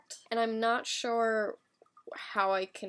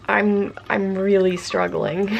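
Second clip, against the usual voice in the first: struggling; 11 dB louder, slower than usual. Real, not acted. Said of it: "having increasingly more difficulty".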